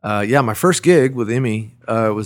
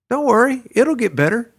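a much higher, lightly voiced kind consolation; a gentle singer consoling a young act; 13 semitones higher, 4 dB louder than usual. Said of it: "in a beautiful [name] way".

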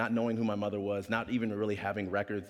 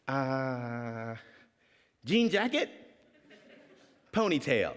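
tentative kid voice, lightly acted; retold child guessing at an answer; unsure child answering; much slower than usual. Child describing his dad to guard.

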